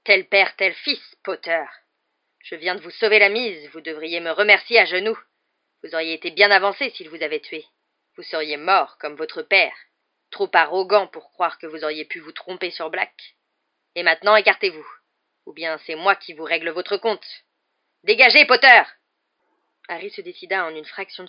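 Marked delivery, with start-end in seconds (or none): 0.0-17.3 loud, venomous, hard-driven rant; raging tirade. A narrator's reading. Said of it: "hurla Rogue qui paraissait de plus en plus dément". 18.0-18.8 high, furious full-voiced yell; shouted order. A narrator's reading. Dernier ordre hurlé par Rogue.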